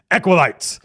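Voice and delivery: a lightly voiced stern inquisitor; commanding head voice. Inquisitor face addressing the acolytes, commanding.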